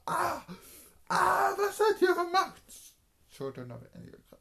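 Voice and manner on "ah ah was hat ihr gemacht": high, loud anguished scream; René's shoulders crunch inward, he screams in agony; screaming in pain. Acted. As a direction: shriek as his shoulders cave in. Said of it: "schrie er nur".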